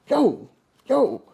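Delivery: shaking his unconscious brother, urgent high call; calling urgently. Retold or imitated. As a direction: shake him awake with your voice. Shaking him real hard.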